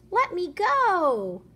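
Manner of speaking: high, loud, slow plea; pleading. Acted. A hooked fish begs the fisherman, high and slow. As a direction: beg to be let free.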